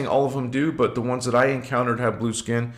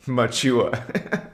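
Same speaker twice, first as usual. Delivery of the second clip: Machiwa. stretched slow with a rising end; slow demo; much slower than usual. Shown as a demonstration. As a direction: draw Machiwa out gently, lifting at the end. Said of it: "Or you can say".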